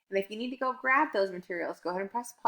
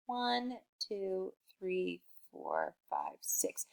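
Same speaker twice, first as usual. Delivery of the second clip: whispered count; 9 dB quieter, much slower than usual. Acted. Teacher counting flowers, whispering the last two.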